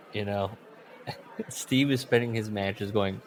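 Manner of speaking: ordinary talk, nothing marked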